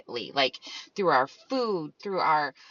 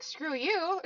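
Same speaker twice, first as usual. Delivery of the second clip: mock angry; 10 semitones higher, 3 dB quieter than usual. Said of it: "I got mad".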